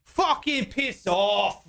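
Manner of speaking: lightly voiced grumpy rock singer, drawn out. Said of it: "And he goes".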